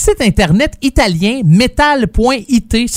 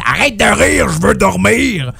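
put-on voice of an annoyed sleeper; imitated grumbling girlfriend. Host imitating girlfriend woken by his laughing, put-on grumble. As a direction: grumble it like a mock-irritated bedmate. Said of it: "elle parle de même, ma blonde".

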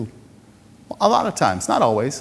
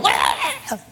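startled mock yelp; 6 semitones higher, 5 dB quieter than usual. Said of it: "this happens and you go".

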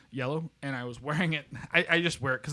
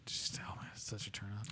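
low, barely voiced murmur; very soft, whispered aside; hushed relish; 5 semitones lower, 14 dB quieter than usual. Real, not acted. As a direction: trail into a whisper, savouring the thought. Savouring a professed turn-on.

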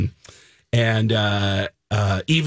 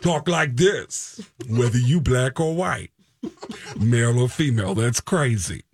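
Tracy Morgan; 3 semitones higher than usual. Retold or imitated. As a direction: do a Bronx comic voice. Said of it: "Tracy Morgan".

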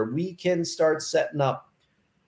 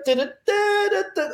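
high hummed ditty; humming cheery tune; faster than usual. Retold or imitated. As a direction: hum a bouncy little tune. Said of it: "They're just like".

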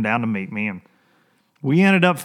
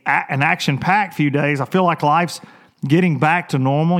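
ordinary talk

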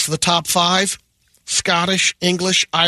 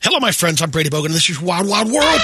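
higher, brisk segment-opening announcement; show intro announcer; 3 semitones higher, faster than usual. Acted. Host introducing Wild Wild World, brisk announcer style. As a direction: launch the segment with showy fanfare.

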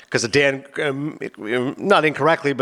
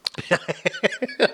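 laughing; 5 semitones higher, 6 dB quieter than usual. Real, not acted. Laughs at Sean Connery joke.

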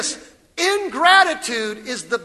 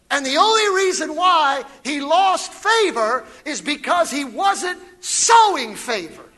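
higher, louder preacher's shout; preaching at full force; 4 semitones higher, 3 dB louder than usual. Real, not acted. Pastor shouting to the church about losing favor, full preaching force. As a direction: drive the point home like a revival preacher.

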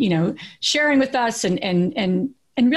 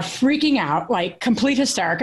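ordinary talk, nothing marked

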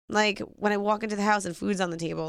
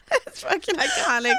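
giggling through the words; cracking up while talking; laughing; 7 semitones higher, slower than usual. Real, not acted. Laughing hard at friend.

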